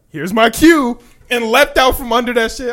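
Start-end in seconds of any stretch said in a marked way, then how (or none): 0.1-1.0 mock heroic line. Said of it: "Deku said".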